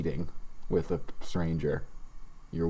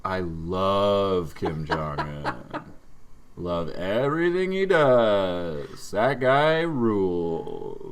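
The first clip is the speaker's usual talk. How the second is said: slow, drawn-out sarcastic praise; exaggerated sarcastic gushing; mock sarcasm; much slower than usual. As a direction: lay the fake adoration on thick. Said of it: "people were not allowed to be like".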